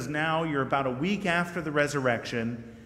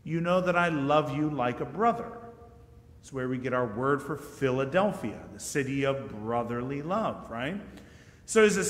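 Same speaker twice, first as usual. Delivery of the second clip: usual speaking voice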